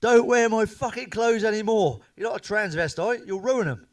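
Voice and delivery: wife shouting. Retold or imitated. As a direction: bellow it at him like the furious missus. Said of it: "She shouted really loudly".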